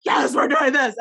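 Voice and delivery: high excited are we doing this. Retold or imitated. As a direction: cheer it like a ride starts. Excited buckled in.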